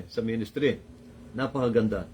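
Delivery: normal talking voice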